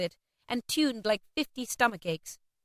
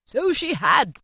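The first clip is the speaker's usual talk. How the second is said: higher, louder heartfelt outburst; impassioned Scrooge, voice raised; crying out; 5 semitones higher, 5 dB louder, slower than usual. A narrator's reading. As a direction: cry out in agreement. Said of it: "cried Scrooge".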